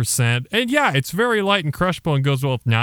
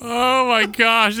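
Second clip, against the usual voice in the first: drawn-out, higher oh my gosh; amused exclaim; 10 semitones higher, slower than usual. Real, not acted. Co-host reacting to the southern accent, amused and higher. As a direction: stretch it out, delighted.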